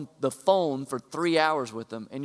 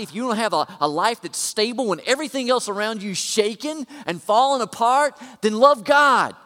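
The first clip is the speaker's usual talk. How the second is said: rising, louder and higher preacher's climax; preaching at full force; 6 semitones higher, 7 dB louder than usual. Acted.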